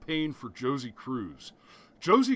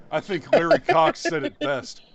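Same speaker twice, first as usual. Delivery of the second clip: higher and louder, thrown out with a flourish; raised emphatic voice; 12 semitones higher, 8 dB louder than usual. Real, not acted. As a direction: announce it like a punchline. Restarts the line in a raised voice.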